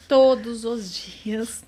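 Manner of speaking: TODOS OS DIAS stretched out very slowly; slow, insistent stress on every word of "Todos os dias"; drawn-out emphasis. Real, not acted. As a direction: say "Todos os dias" word by word, dragging each one. Repeated slowly for emphasis on frequency.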